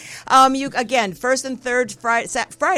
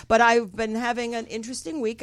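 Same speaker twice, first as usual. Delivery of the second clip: usual speaking voice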